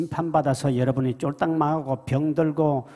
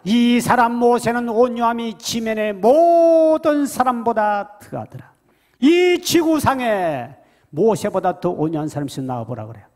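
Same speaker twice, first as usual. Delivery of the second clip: full-force scripture reading; 9 semitones higher, 7 dB louder than usual. Acted. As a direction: proclaim the verse to the church. (천천히 힘차게 외치는 성경 낭독)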